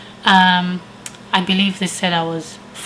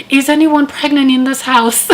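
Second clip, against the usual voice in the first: raised voice calling through the door; 6 semitones higher, 4 dB louder than usual. Retold or imitated. Paramedics at the door calling into the house, raised. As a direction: call out to the house to find the patient.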